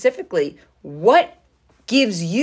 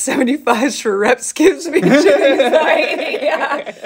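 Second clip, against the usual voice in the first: laughing; 6 semitones higher, 4 dB louder than usual. Real, not acted. Laughing while reading a teasing comment.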